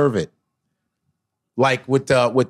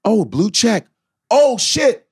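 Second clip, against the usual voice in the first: rising excitement; 10 semitones higher than usual. Retold or imitated. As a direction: build from curious to stunned. Discovering real follow.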